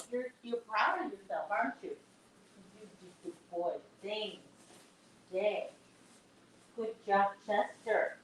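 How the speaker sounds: soft cooing. Real, not acted. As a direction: coo gently to the dog. Softly praising the dog.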